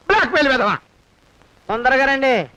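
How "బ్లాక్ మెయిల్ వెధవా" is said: angry outburst. Acted. Cornered boss insulting his blackmailer, angry outburst. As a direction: insult thrown out with more force.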